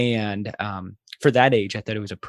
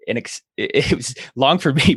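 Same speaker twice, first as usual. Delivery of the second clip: ordinary talk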